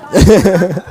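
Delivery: sudden loud, high burst of laughter. Real, not acted. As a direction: crack up at the answer. Laughs at who is messy.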